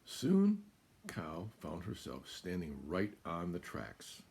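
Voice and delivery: put-on lively storybook narration to a child; reading to children. A narrator's reading. Parent reading a farm story aloud to a child, animated. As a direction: read it like a bedtime picture book.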